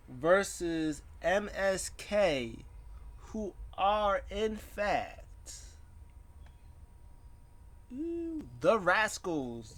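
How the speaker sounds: high, stretched-out ring announcer call; playful announcer. Pretend. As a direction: draw out each name with big swoops. Announces the tag team.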